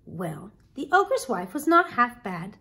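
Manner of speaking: usual speaking voice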